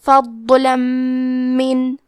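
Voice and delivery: فضلا من with the tanween merged into a doubled, humming م, very slow; فضلا من drawn out, nasal doubled م; idgham with ghunna. Shown as a demonstration. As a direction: run فضلا into من with a long nasal م. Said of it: "تنوین کو میم میں ملا کے غنہ اور تشدید".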